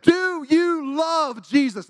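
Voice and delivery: high, loud, drawn-out imitated challenge; revival preacher. Evangelist challenging his congregation, slow imitated preaching shout. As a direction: stretch each word into a preacher's holler. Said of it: "this man was up there preaching".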